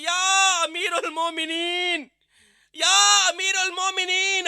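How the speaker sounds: slow, loud, high call with a cry in it. Acted. Voice from the tent calling for the Commander of the Faithful, loud and slow. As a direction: stretch the title out in an exalted cry. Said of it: "خیمے کے اندر سے آواز آئی".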